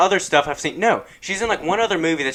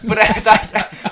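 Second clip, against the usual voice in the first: laughing; 6 semitones higher, much slower than usual. Real, not acted.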